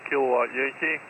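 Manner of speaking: normal talking voice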